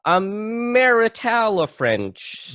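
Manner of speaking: high, slow slurred blend of nationalities; evasive bluff. Pretend. Improviser bluffing a fan about accent, high drawn-out slur. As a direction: stretch the made-up word upward, fudging it.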